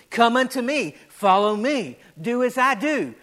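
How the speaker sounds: loud, building preacher voice; preaching. Acted. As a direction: preach it with rising force.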